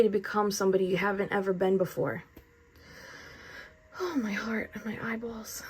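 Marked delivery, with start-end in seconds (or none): 4.0-5.6 hushed, airy near-whisper; overcome. Real, not acted. Tarot reader moved by her own message, overcome whisper. Overcome while reading the cards.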